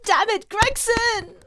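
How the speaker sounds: high, slow exasperated cry; mad outburst. Real, not acted. Player angry at Gregson for dying, drawn-out exasperated outburst. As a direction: curse him, drawing it out high. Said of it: "I'm so mad".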